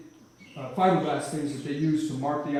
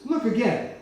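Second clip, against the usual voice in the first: God's gentle command; 7 semitones higher, 4 dB louder than usual. Retold or imitated. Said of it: "He says".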